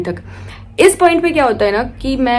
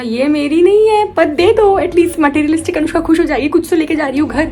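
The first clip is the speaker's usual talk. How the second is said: pleading; 6 semitones higher, 4 dB louder than usual. Retold or imitated. Begging for someone else's trophy.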